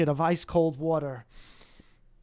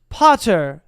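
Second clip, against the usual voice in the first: usual speaking voice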